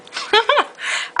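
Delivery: high giggling fit; cracking up mid-read; burst of laughter. Real, not acted. Reader breaking up at her own fanfic, laughing.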